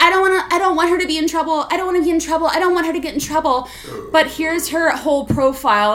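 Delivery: high, rushed mock pleading; fake tearful pleading. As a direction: imitate a fake-innocent tattletale, loud and fast. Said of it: "she was screaming and crying".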